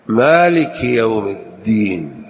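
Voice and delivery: الدين slow, with a held voiced د; geminated dal in الدين; doubled clear dal. Shown as a demonstration. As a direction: press the د in الدين. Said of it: "الدال في الدين محققة مشددة".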